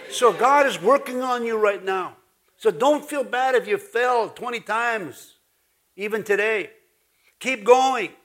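emphatic revival-style preaching; full-force preaching. Real, not acted. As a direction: preach it out to rouse the congregation.

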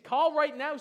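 put-on Irish accent. Friend urging Steve to call, in Irish accent. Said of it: "in his Irish accent".

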